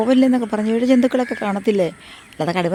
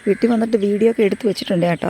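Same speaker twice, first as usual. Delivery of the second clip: ordinary talk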